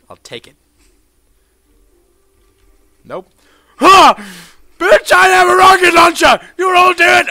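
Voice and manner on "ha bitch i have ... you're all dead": high loud gloating yell; triumphant shout. Real, not acted. Yelling triumph at everyone after grabbing the rocket launcher. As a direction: crow over the rocket launcher.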